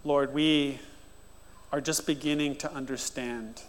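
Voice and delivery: soft, reverent pastoral prayer; quiet heartfelt prayer over music; earnest prayer. Acted. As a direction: pray aloud over the congregation, hushed. Leads a prayer.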